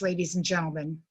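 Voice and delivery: usual speaking voice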